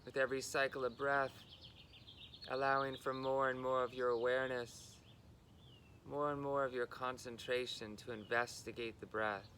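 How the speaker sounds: unhurried guidance, level in pitch, pausing between phrases; guided meditation. Real, not acted. Guiding listeners into body and breath, calm meditation delivery.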